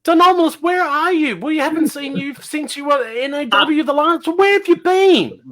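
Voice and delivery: high-pitched, incredulous questioning; astonished greeting. Acted. Host to long-lost wrestler on stream, astonished. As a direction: demand answers in a laughing, high-pitched squawk.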